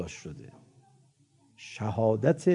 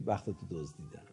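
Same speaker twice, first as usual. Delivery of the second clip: devilish gloating; 6 semitones lower, 14 dB quieter than usual. Retold or imitated. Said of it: "ببین میگه".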